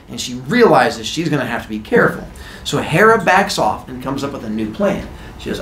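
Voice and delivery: usual speaking voice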